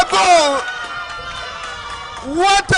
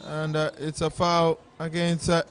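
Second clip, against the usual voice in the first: steady match commentary; live play-by-play; 4 dB louder than usual. Real, not acted. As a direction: call the game as it happens.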